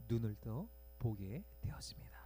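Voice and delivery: hushed, voiceless telling of the miracle; whisper. A narrator's reading. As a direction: let the wonder sink in. Climax of the healing story. (속삭이듯 전하는 기적)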